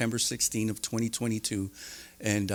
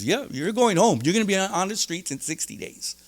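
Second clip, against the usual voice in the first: higher, cheery confirmation; upbeat counselor voice; 8 semitones higher than usual. Retold or imitated. Counselor confirming release date, high and cheerful. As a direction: deliver good news brightly. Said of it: "He goes".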